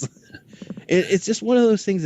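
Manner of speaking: ordinary delivery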